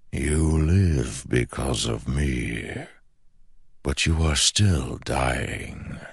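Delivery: slow ancient shadow voice. Acted. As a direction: speak like a patient shadow god. The shadow speaks.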